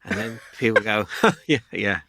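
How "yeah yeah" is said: flat, uninterested reply; deadpan. Retold or imitated. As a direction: say it deadpan, no interest. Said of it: "you just are deadpan".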